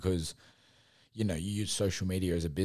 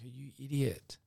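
amused self-mockery; 6 semitones higher, 3 dB quieter than usual. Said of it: "I laughed at myself".

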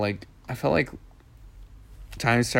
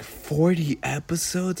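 retold amazement; 6 semitones higher than usual. Retells own amazement at the number.

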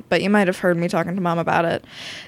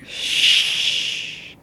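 drawn-out unvoiced hush; long shush. Child imitating soldiers silencing everyone, long shush. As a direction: shush a crowd at length.

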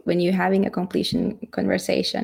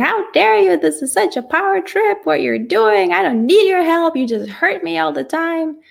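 going bananas; 10 semitones higher than usual. Retold or imitated. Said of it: "go bananas and be like".

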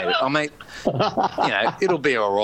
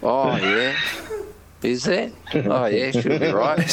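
unbothered reply; slower than usual. Retold or imitated. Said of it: "they're so laid back".

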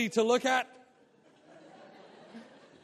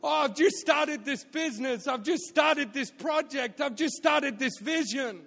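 excited; 5 semitones higher than usual. Retold or imitated. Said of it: "your version of that".

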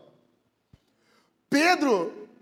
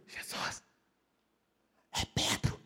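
unvoiced whisper; hushed whisper; 12 dB quieter, slower than usual. John quietly asking Jesus, whispered. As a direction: whisper it behind a hand.

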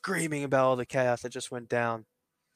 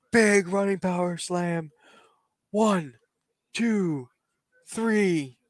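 drawn-out referee count, a little raised; play-by-play finish; 5 semitones higher, 3 dB louder, much slower than usual. Acted. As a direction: punch the big move, then count the pin. Calls the finishing move and count.

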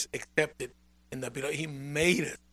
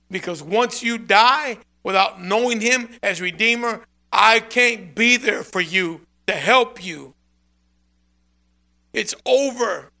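urgent plea; 3 semitones higher, 6 dB louder than usual. Real, not acted. Preacher warns of death, pleading.

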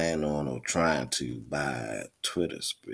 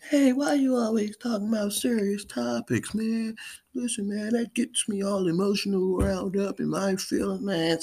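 high-pitched mock complainer voice; high whiny imitation of a viewer; 3 dB louder than usual. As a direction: squeak it out like a whiny fan.

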